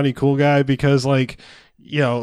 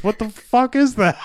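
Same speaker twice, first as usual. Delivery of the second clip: normal talking voice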